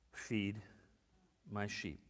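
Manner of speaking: low, very soft, with a long pause; hushed solemn command. Retold or imitated. Jesus giving hurt Peter his charge, hushed and solemn. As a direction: let each part fall low and quiet.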